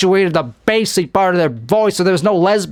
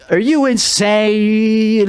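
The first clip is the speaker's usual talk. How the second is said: drawn-out question; 5 semitones higher, much slower than usual. Acted. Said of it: "In other words".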